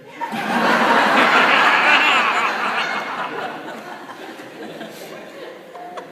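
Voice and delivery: loud unvoiced laughter; big laugh. Real, not acted. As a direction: burst out laughing. Crowd laughs at punchline.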